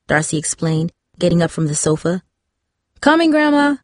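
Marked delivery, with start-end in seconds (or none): 3.0-3.8 yelling. A narrator's reading. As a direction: call out to the bedroom. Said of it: "she yelled".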